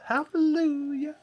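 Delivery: high, slow mock praise; mock hallelujah. Cohost hails a rain of clams with mock hallelujah. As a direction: sing out a hammed-up hallelujah.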